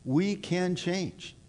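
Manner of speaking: We can change, drawn out slowly; slow it right down and land it; deliberate reassurance; slow emphatic. Acted. Repeated for emphasis, slowed.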